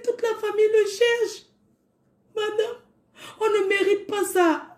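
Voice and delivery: high, tearful; tearful plea. Real, not acted. Sister pleading to the neighbour, tearful and high. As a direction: plead through tears. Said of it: "still crying".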